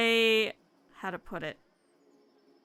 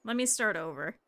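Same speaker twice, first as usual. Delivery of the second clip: ordinary talk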